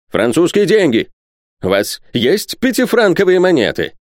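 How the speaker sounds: high-pitched, fervent demand; heated eagerness. A narrator's reading. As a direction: fire off urgent questions, pitched high. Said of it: "продолжал профессор с горячностью".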